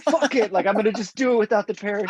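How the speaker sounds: high, quick daredevil outburst; reckless movie hero. Retold or imitated. Acting out the hero before he jumps, reckless. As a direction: rush it out high, as if about to jump. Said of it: "I always thought he said".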